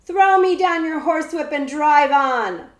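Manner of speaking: loud, high order; shouted order. A narrator's reading. Big outlaw shouts up to the coachman, a loud order. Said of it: "the big outlaw shouted".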